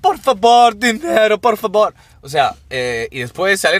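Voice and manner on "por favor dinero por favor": súplica exagerada, más aguda y fuerte; mendigo teatral. Retold or imitated. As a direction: hacer el papel del mendigo llorica. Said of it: "se mete en el papel". (imitando al mendigo del metro pidiendo dinero, más agudo y fuerte)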